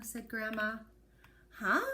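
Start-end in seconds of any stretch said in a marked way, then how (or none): 1.6-1.8 put-on a boy baffled by a riddle; puzzled huh. A narrator's reading. Johnny confused.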